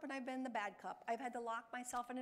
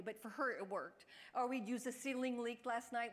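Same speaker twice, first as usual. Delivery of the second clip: ordinary talk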